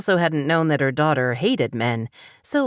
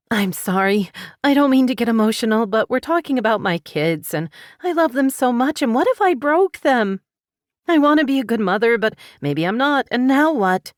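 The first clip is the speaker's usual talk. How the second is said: higher and louder, fast, voice cracking; pouring it out as tears break through; 8 semitones higher, 4 dB louder than usual. A narrator's reading. Mother confessing fear for her daughters, breaking down. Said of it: "The tears came fast".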